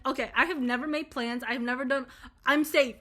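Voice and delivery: normal talking voice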